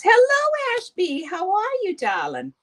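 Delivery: sweeten it up in a fake way; hammed-up fake-sweet hello; mock sugary greeting. Mocking someone's sugary greeting.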